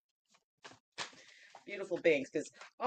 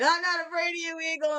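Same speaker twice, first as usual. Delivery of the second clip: defiant rabbit; 8 semitones higher than usual. Pretend. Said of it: "rabbit defying the eagle".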